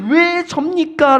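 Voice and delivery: high, slow, stretched-out cry; protesting. Acted. Asking God why it is me, protesting. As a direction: voice a believer's protest upward. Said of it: "라고 항변하고 계십니까?".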